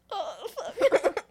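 high, whimpering fake sob; hammed-up tearful reaction with a laugh in it; mock crying. Narrator mock-crying at Lilu's line.

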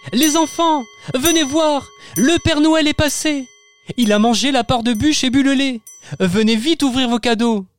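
high, delighted mother voice; excited mother calling. Acted. Mother calling the children at dawn, high delighted voice. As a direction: call the kids down to their presents.

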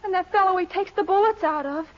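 high-pitched melodramatic worry; distraught fretting. Acted. Woman alone fearing for kidnapped Dennis, distraught fretting.

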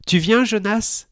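high, slow call across a distance. A narrator's reading. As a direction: call out to a friend. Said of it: "s'écrie Emma".

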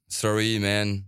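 lightly put-on bad American English accent; dodgy American accent. Star DJ tells his nervous host the cash falls short, mock American accent. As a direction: imitate an American with a slightly rotten accent. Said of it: "avec un accent un peu pourri".

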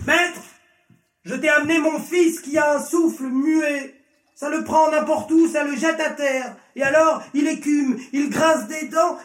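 high-pitched, urgent father's appeal; desperate plea. A narrator's reading. Father begging Jesus for his possessed son, desperate. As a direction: pour it out high and pleading.

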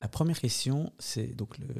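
ordinary talk

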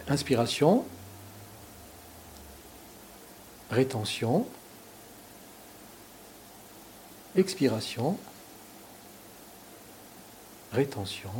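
guided breathing. Shown as a demonstration. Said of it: "On essaye?".

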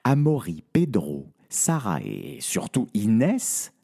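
usual speaking voice

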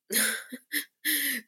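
high, rueful laugh; short high laugh after the punchline. Real, not acted. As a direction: laugh at the bad timing. Laughs at covid's arrival.

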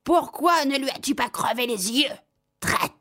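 high mock witch cry; a touch of a furious crone's yell; hammed-up angry witch scream at the cat; shouting. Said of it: "et cria".

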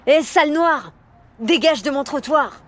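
put-on a teenage bully yelling, pitched high; shouted insult. A narrator's reading. Bully boy yells at Rosa on the street, high jeering shout. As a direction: sneer and yell it down at the girl. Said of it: "avait-il crié sur Rosa".

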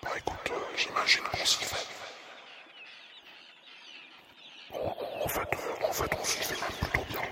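hushed airy whisper; ASMR whisper. Acted. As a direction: whisper close to the mic.

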